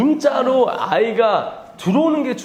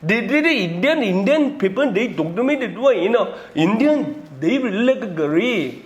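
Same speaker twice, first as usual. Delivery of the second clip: slow mock Indian English, laughing at the end; playful take-off of an Indian speaker; Indian accent imitation; 2 semitones higher, much slower than usual. Lecturer mimics Indians again for the class, slow laughing mock Indian accent. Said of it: "인도 사람이".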